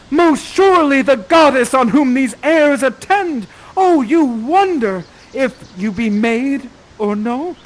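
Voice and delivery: smitten Ferdinand. Acted. Host voicing Ferdinand smitten at first sight of Miranda. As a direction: declaim it to your goddess. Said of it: "you cry out and say".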